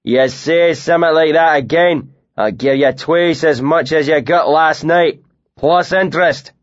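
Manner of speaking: a burly northern drinker jeering at his mate; thick northern accent with a mocking edge. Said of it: "thick northern accent".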